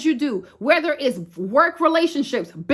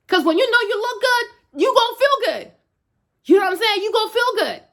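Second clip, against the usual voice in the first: pitched up high with real excitement; excited pep talk; 7 semitones higher than usual. Real, not acted. Hyping viewers up about looking good, high and excited. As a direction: lift it high and hype them up.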